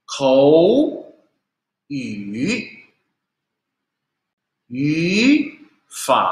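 each first syllable like "Ni", "ke", "kou" rising, second dipping; tone change drill. Shown as a demonstration. Teacher reading practice words with learners, second then third tone. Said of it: "depannya harus, eh, menjadi nada dua".

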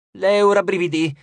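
ordinary delivery, nothing marked

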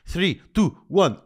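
ominous countdown. Pretend. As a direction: count down like a bomb about to go off. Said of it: "it sounds like this".

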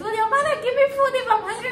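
put-on high, fast child's voice; squeaky kid asking over and over; imitating a child. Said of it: "he kept asking the other boy".

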